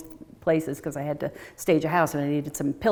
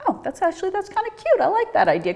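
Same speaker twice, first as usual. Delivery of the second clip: pleasantly surprised; 14 semitones higher than usual. Retold or imitated. Retold pleased reaction to penguin idea.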